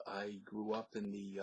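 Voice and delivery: ordinary talk, nothing marked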